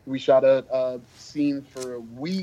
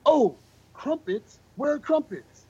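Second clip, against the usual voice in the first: high, slow, eager mock excitement; famous actor thrilled at crumpets, high and eager; excited; 12 semitones higher than usual. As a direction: imitate a star's sudden delight at crumpets. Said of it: "he very excitedly goes".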